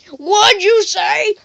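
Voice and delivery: put-on high, slow old-timer's holler; old man. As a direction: yell it drawn out like an old geezer. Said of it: "like, this old guy".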